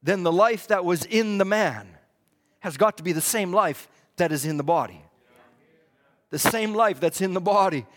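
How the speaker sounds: low, softened voice with long pauses; hushed preaching. Real, not acted. Preacher reading scripture to the church, hushed and deliberate. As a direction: bring the sermon right down, measured and grave.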